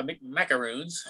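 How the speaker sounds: "Mick Macaroons" with Mick as a stumbled first syllable; mock stutter. Customer ordering French dessert at McDonald's, stuttering "Mick Macaroons". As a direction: say "Mick Macaroons" like tripping on the start of macaroons. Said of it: "Are you stuttering?".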